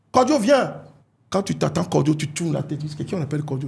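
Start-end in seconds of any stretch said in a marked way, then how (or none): 0.1-0.8 calling out. Retold or imitated. Stranger calls a name in street.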